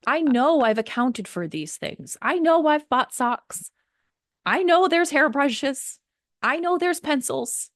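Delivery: stack each "I know" with rising frustration; exasperated. Real, not acted. Building frustrated list.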